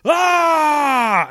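high, loud sudden battle cry; imitated desert ninja shouting at the heroes; mock ninja yell. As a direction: jump out with a shout. Ninja yelling from behind.

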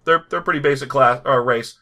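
usual speaking voice

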